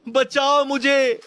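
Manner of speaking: very high, loud, slow wail of बचाओ मुझे; drawn-out painful scream. Acted. Trapped man in the mine wails slowly for help. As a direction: stretch the scream out in agony. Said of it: "किसी की दर्द भरी चीख".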